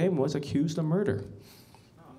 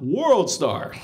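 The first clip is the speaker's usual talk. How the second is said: loud World Star call; mock crowd yell; 6 dB louder than usual. Bystanders filming a fight, loud yell. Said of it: "they scream out".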